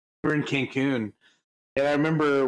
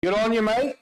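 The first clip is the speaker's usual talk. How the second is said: put-on pitched-up, hammy Aussie accent; broad mock-Australian, tossed up at the end; 9 semitones higher, 3 dB louder than usual. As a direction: put on a high, chirpy Australian voice. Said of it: "maybe just do the Australian".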